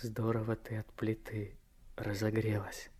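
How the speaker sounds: soft unvoiced murmur in her ear; intimate whisper. Vanyushka whispers to Anka in an embrace. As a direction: whisper close while holding her.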